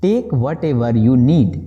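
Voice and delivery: very slow, word by word; slow model sentence. Shown as a demonstration. Teacher modelling the English phrase slowly for students. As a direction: spell it out slowly for learners. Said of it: "बोलेंगे".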